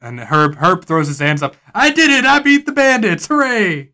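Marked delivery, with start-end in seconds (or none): 1.8-3.9 high, loud triumphant cheer; gleeful cheer. Acted. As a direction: shout it like a proud kid who won. Herb cheers victory.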